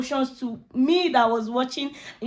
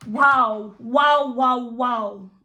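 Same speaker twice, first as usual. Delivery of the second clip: excited wow; 4 dB louder, much slower than usual. Real, not acted. Amazed by actress performance.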